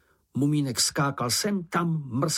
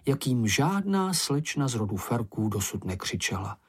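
ordinary delivery

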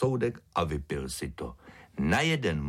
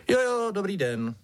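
high-pitched ringmaster, falling away; absent-minded reply; 13 semitones higher, 4 dB louder than usual. A narrator's reading. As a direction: return the greeting distractedly in a high voice. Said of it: "zamyšleně odpověděl principál".

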